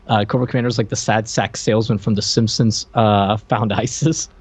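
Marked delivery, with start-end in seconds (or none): none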